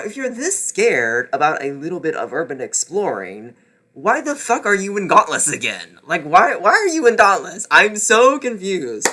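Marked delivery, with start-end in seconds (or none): 3.9-9.1 louder, incredulous rant; exasperated rant. Real, not acted. Reviewer ranting at a Dauntless member's fear, exasperated. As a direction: vent your disbelief at the book. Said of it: "ranting at the book".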